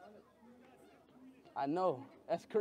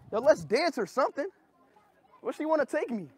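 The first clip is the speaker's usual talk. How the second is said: ordinary delivery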